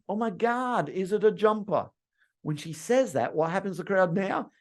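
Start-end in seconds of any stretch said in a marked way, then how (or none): 0.1-1.9 high-pitched New York accent, imitated; thick New York accent. Lady reacting to someone on a building edge, imitated thick New York accent. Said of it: "real thick New York accent".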